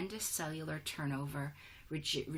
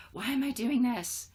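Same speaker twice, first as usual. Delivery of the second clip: higher and louder, exasperated cry; fed-up outburst; 7 semitones higher, 8 dB louder than usual. Retold or imitated. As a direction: blurt it out at the end of your rope. David fed up, stressful day.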